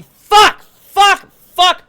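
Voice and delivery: high, loud screamed outburst; imitated distraught screaming. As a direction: scream it out like a streamer breaking down. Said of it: "they're crying and they're screaming".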